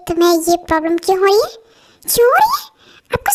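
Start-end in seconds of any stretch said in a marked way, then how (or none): 2.0-3.3 incredulous. Acted. Exasperated at his question.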